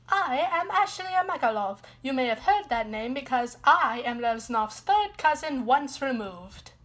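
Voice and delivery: lightly put-on Italian accent; mild mock Italian accent. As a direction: introduce yourself in a hint of Italian accent. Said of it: "why do you have an Italian accent".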